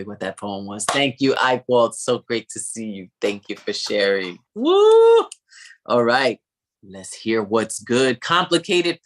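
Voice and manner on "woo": high loud cheer. Real, not acted. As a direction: whoop for the poet. Host cheers.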